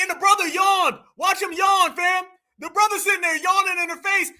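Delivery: ordinary talk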